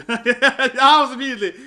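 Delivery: real laughter breaking through the words; laughing. Real, not acted. Narrator laughing at his own know-it-all past, real laugh.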